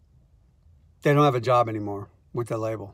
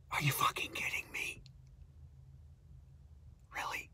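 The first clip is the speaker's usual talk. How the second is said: unvoiced exasperated whisper; whispered disbelief; 21 dB quieter than usual. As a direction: hiss it under your breath in disbelief. Exasperated aside to himself.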